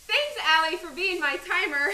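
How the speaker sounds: loud, high giggly thanks; 1 laughing thanks. Real, not acted. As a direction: thank her while still laughing. Teasing thanks through laughter.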